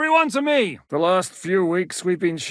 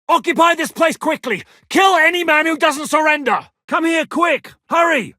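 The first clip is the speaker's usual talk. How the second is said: higher, louder shouted commands; battle orders; 6 semitones higher, 5 dB louder than usual. Acted. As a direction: drive the men on mid-fight.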